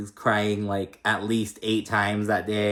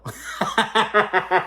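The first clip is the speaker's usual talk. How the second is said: bright high laugh burst; high giggle; 10 semitones higher than usual. Real, not acted. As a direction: laugh at how cute they are. Laughing at friends' chat.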